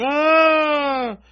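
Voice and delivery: loud, high, sustained scream of a fleeing dog; mock scream. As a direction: let out a long comic scream. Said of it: "leaps out and runs away going".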